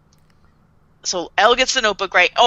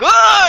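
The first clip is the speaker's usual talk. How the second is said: high, loud comic scream; short imitated yell of panic; hammed-up startled cry; mock freak-out scream; 12 semitones higher, 8 dB louder than usual. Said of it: "and he freaks out".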